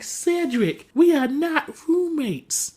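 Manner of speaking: mock stern and slow; firm refusal. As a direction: spell it out word by word. [name] firmly refusing.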